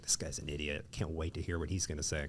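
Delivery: quiet, sneering aside; snide mutter. Retold or imitated. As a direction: mutter it like a smug rival in the gallery. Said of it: "we're all like".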